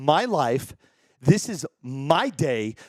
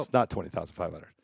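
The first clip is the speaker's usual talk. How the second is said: lower, softer, taking the joke back; quiet real correction; 10 semitones lower, 6 dB quieter than usual. Real, not acted. Streamer owning up after his joke, lower and softer. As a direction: drop the act and admit it quietly.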